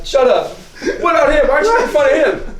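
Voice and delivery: very high, loud mock protest; flustered deflection. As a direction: squeal it to push the teasing onto someone else. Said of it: "you're just like".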